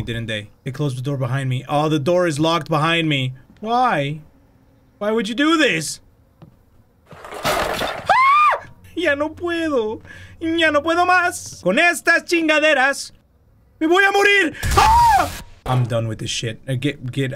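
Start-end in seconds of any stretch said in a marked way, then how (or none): 0.6-1.6 quicker, realizing it; faster repeat. Real, not acted. 7.1-8.7 sudden high frightened scream; real scream. Real, not acted. Jump scare in horror game. 8.9-14.6 panicked. Real, not acted. Panicking after the jump scare. 14.8-15.4 loud high frightened scream; real scream. Real, not acted. Second scream.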